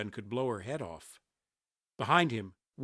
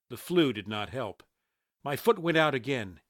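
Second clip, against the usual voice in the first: plain reading voice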